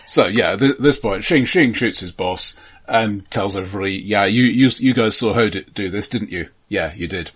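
ordinary talk

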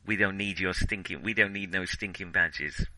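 normal talking voice

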